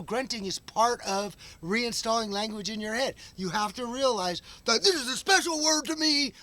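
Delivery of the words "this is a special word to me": high, mock-precious protest; put-on pleading, pitched up high; mocking imitation. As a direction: mimic someone emotionally attached to a word. Mimics someone attached to a word.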